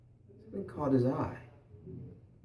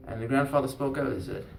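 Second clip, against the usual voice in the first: ordinary delivery, nothing marked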